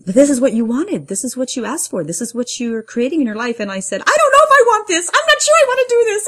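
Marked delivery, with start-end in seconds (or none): 0.0-3.6 lightly voiced insistent universe, higher; a hint of the universe reasoning with someone; gently acted insistence, pitched up. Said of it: "I heard, like, the universe say". 4.0-6.3 very high, loud, fast acted panic; frantic protest at the top of the voice; wanting out. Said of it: "part of me really wanted to back out".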